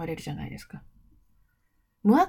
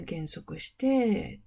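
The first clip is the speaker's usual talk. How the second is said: drawn out very slowly like a braking train; slowing down; 3 semitones lower, much slower than usual. Shown as a demonstration. A teacher comparing a phrase to a train braking, said very slowly. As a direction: ease the words to a stop.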